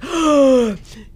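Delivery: loud, dramatic gasp of shock; hammed-up astonished gasp; huge mock gasp. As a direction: gasp as if meeting your idol. Retelling boy's shocked realization.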